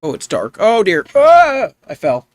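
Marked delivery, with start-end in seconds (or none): 1.1-1.7 sharp, high yelp from a sudden fall; startled high cry of falling. Real, not acted. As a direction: cry out as you drop. Falls into dark cave.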